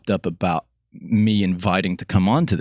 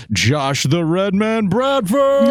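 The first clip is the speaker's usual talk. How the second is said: drawn-out, high, loud wrestling intro; ring announcer; 12 semitones higher, 4 dB louder, much slower than usual. Acted. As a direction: stretch the name like a ring announcer. Ring-style guest introduction.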